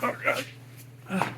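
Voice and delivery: effortful voice squeezing out from under a car; breathless groan, crawling out; straining while crawling out. Real, not acted. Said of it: "see if I can crawl out of here".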